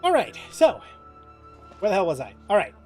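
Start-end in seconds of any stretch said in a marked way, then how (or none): none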